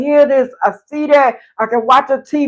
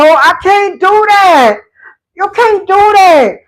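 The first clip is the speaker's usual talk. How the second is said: slow, raised, crying out; tearful preaching; 7 semitones higher, 4 dB louder, slower than usual. Real, not acted. Urging listeners about letting God lead, crying out. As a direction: plead through tears.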